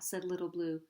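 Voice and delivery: usual speaking voice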